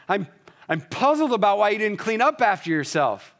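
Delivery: gentle words spat out harshly. Shown as a demonstration. Pastor showing a harsh tone with gentle words, sharp and loud. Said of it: "you can sound harsh while using gentle words".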